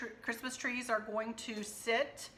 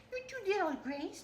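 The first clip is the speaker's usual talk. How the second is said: talking to the dog; 10 semitones higher than usual. Real, not acted. Vlogger greeting her little dog Gracie in high pet talk. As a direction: coo at the puppy.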